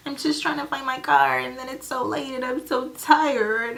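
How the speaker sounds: super dramatic. Retold or imitated. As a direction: ham up being lost and worn out. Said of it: "Super dramatic".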